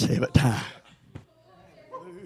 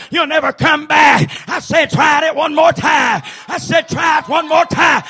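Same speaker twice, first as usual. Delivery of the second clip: high, loud whooping preacher cadence; fiery revival preaching; preaching at full force; 13 semitones higher, 10 dB louder, slower than usual. Acted. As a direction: shout it out, driving each line home. Preacher builds to shouted climax.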